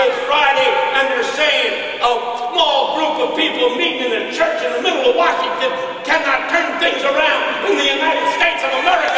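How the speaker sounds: high, driving sermon cadence; impassioned preaching. Acted. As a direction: rouse the congregation with mounting urgency.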